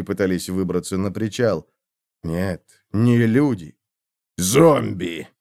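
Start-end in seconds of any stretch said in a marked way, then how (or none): 4.4-5.3 hoarse croak. A narrator's reading. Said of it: "прохрипел Готрик".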